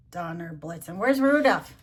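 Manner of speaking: ordinary delivery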